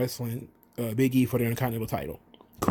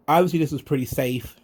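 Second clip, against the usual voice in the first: ordinary delivery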